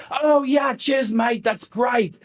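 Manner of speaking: hammed-up British accent, pitched well up; heavy British accent. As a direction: put on a big, eager British "cheers, mate" voice. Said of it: "in his heavy British accent".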